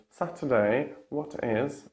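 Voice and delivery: British "Saturday, what is", T's crisply released; British accent with clear T's; British true T. Shown as a demonstration. Teacher contrasts the British way, true T. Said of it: "And in British".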